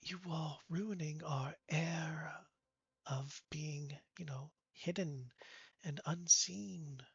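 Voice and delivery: hushed, breathy whisper; secretive hiss of a whisper. Acted. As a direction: whisper it so nobody hears. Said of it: "he kinda goes".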